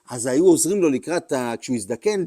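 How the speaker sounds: normal talking voice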